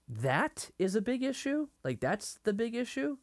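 high, rising disbelief at Nicodemus' words; incredulous question. Retold or imitated. Nicodemus to Jesus, shocked, high incredulous rise. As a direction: balk at the idea, voice climbing high. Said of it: "he almost says it like".

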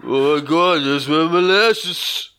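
put-on loud, slow Boston accent; drawn-out hammy Boston accent.

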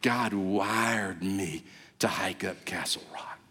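awed whisper. Pretend. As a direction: breathe it out in awe at the summit. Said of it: "you're gonna be saying".

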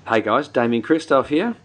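normal talking voice

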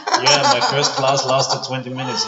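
laughing out loud. Real, not acted. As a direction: laugh through the words. Laughing while telling story.

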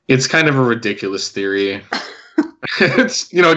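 normal talking voice

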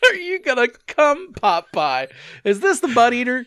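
high-pitched teasing question, dropping on the name; mocking girlfriend's tease. Co-host echoing what the girlfriend asks, high mock teasing.

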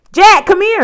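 high, loud urgent holler; shrill, full-voiced call. A narrator's reading. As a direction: yell for help from over the hill. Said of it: "Annie called".

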